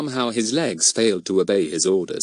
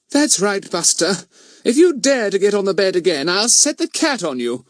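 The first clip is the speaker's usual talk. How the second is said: high, firm mother's voice; scolding mother; 10 semitones higher, 4 dB louder than usual. Acted. Mother warns the dog off the bed, scolding.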